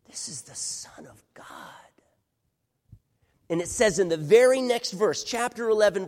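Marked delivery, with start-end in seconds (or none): 0.1-1.5 whispered, hushed reveal; awed whisper. Real, not acted. Preacher telling the crowd who Jesus is, whispered. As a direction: breathe it out in reverence.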